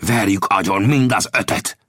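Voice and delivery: mildly throaty, savage urging; rasping growl. Acted. Gibson urging his men to kill, rasping. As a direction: growl out the threat. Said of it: "hörögte Gibson".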